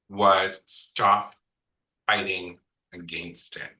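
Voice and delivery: ordinary delivery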